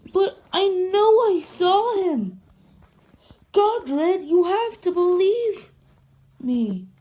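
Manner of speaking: high, loud insistent pleading; desperate plea. Acted. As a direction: beg him to believe you. Pleading to be believed.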